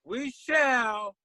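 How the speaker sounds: lightly voiced rapper at the mic, drawn out; a hint of a stage MC warming up. Imitating the MC on stage.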